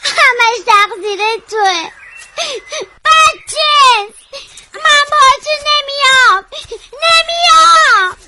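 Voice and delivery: high, loud wail of refusal; crying yell. Acted. Child blaming brother and refusing to move, crying and shouting. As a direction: shout it through tears, stretching the words.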